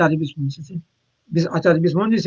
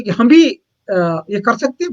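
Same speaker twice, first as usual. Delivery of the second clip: ordinary talk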